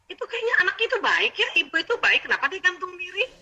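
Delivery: shocked. Retold or imitated. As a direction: gasp it out in disbelief.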